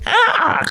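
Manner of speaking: very high squawked crow cry. Retold or imitated. The crow answers Nityananda's order with a very high crow squawk. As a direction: caw like a startled crow. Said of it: "The crow was going".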